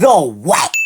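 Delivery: loud disbelieving question; can't believe what she heard; incredulous. Real, not acted. Reacts to strange claim.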